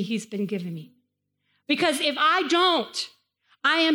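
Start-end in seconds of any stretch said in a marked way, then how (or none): none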